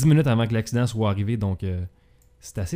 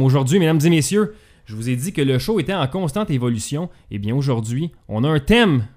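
loud, raised-pitch segment introduction; show announcer; 4 semitones higher, 4 dB louder than usual. Acted. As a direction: build up to a big announced entrance.

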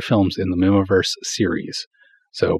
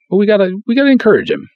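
eager plea; 13 semitones higher, 3 dB louder, faster than usual. Real, not acted. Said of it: "let's encourage him, shall we".

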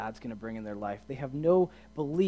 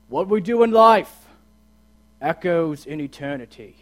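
booming, much louder movie-general rallying cry; Russell Crowe voice; 3 semitones higher, 14 dB louder than usual. Retold or imitated. Pastor booming a general's battle speech to the youth group. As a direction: bellow it like a general rallying troops before battle. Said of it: "my best Russell Crowe voice".

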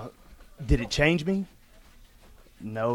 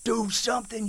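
high, strained shout at God; cursing God; 9 semitones higher than usual. Retold or imitated. A man cursing God in the freezing sleet, high and strained. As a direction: hurl it at the sky, daring him. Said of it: "I'm cursing God and I'm calling him out".

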